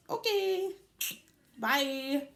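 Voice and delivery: brushing off the dramatic text; flippant brush-off. Retold or imitated. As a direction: toss it off breezily. Dismissive brush-off.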